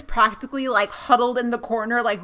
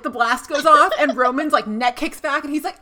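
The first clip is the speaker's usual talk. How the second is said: ordinary talk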